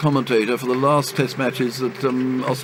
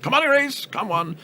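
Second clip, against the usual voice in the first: much higher call across the field; calling for a run; 12 semitones higher than usual. Retold or imitated. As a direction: shout for the run, pitched right up.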